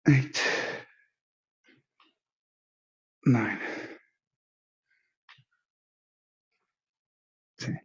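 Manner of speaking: quiet counting under the breath. Real, not acted. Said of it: "count under my breath".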